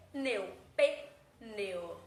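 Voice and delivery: "Pneu" broken slowly as pe... neu, twice; syllable by syllable. Shown as a demonstration. Teacher showing how the word separates, syllable by syllable. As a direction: split "Pneu" into pe and neu, pausing between. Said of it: "Separação silábica dessa palavra".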